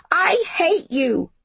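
high, slow, wailing cry; howled. Acted. Jamie howling at Miss Smith down the street. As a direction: let the howl peak. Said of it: "he howled".

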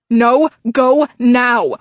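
slow, loud, no argument; firm command. A narrator's reading. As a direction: order it, leave no room to refuse. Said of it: "Her voice brooked no argument".

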